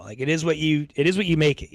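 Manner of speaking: ordinary talk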